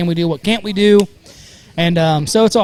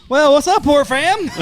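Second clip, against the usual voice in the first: hyped greeting; 9 semitones higher, 4 dB louder than usual. Real, not acted. Repeated loud show greeting.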